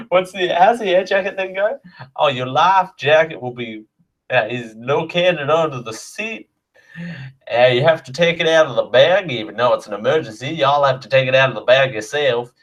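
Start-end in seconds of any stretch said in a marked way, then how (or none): 2.2-12.5 hammed-up Southern US air hostess safety briefing; a mock Southern US flight attendant walking through safety; Southern US accent. Air hostess briefs passengers in a hammed-up Southern US accent. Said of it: "could you imagine me going".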